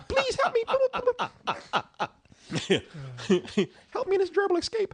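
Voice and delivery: very high, squeaky little voice pleading through laughter; high squeak of a trapped bird, half laughing. Pretend. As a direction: play a tiny bird begging to escape. Voicing a bird trapped inside.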